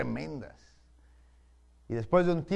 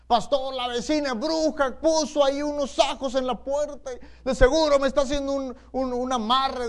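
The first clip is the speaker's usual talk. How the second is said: high, loud, anxious tattling; frightened church sister complaining to the pastor; alarmed complaint; 9 semitones higher, 8 dB louder than usual. Retold or imitated. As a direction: panic that the neighbor is a witch. Fearful sister accuses neighbor of witchcraft.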